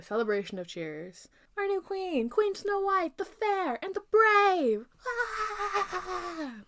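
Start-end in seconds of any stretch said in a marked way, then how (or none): 1.6-6.6 much higher, jubilant proclamation; crowd hailing. Acted. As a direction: proclaim the new queen with joy. Crowd hailing new queen.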